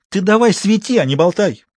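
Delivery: high, curt rebuke; snappy. A narrator's reading. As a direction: tell him to shut up and shine. Sister snaps at doctor.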